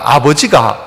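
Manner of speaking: 아버지가 in Sangju dialect with the last syllable drawn out and falling. Shown as a demonstration. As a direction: say 아버지가 like a Sangju local, 가 stretched and dropping. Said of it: "상주 쪽 가니까". (상주 사투리로 아버지가)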